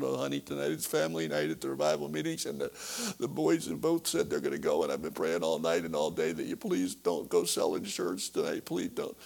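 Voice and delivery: high, begging wife's voice; imitated wife begging her husband; tearful plea. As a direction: plead with him through tears.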